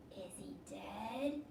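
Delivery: soft, slow scared whisper; a boy afraid the man is dead. Acted. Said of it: "Toby whispered".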